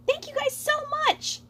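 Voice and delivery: high excited troopa voice; joyful thanks. Acted. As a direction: gush with gratitude.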